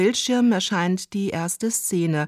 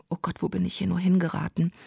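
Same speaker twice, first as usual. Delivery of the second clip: normal talking voice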